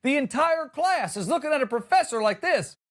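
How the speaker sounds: ordinary talk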